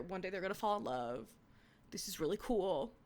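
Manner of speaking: ordinary talk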